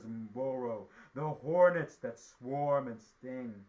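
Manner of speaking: loud, booming proclamation of a great price; mighty sky god. A narrator's reading. Sky god naming his price to the spider, booming. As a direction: announce each fearsome creature with grandeur.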